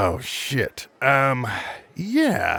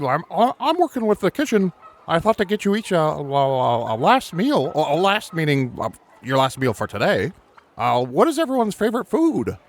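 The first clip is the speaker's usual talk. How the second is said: Brass character voice; 8 semitones higher than usual. Acted. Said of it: "so Brass is gonna say".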